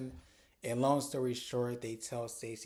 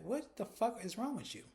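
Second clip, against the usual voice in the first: exasperated disbelief; 10 semitones higher than usual. Real, not acted. Scolding Kiana over Wendy.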